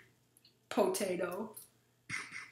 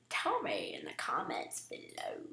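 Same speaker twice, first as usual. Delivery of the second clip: whispered aside; 5 semitones lower, 6 dB quieter than usual. Real, not acted. Aside after question.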